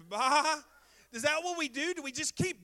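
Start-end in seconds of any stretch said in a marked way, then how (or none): 0.1-0.6 loud imitated sheep "baa"; sheep bleat. Preacher jokes about shepherding the sheep, loud mock bleat.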